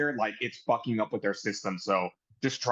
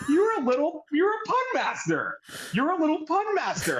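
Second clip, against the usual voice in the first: high-pitched voice quoting a teasing woman; mock-delighted teasing, pitched way up; playful teasing imitation. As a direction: tease in a put-on high voice. Voices the wrestler's playful teasing reply.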